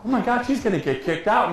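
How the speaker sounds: high-pitched, worried inner voice; mock panic. Broadcast partner fearing an ejection, high mock panic. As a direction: play up the dawning alarm. Retold panicked thought during outburst.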